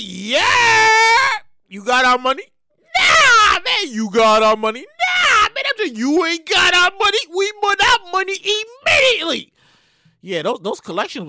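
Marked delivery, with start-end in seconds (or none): 0.0-9.5 pushy, shouted New York collector act; hammed-up New York accent, raised in pitch. Debt collectors demand their money, in a loud New York accent. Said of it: "my New York accent".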